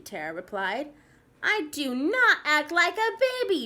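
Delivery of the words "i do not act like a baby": put-on loud, higher indignant child; yelled protest. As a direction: yell it back, insulted. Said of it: "Brendan yelled".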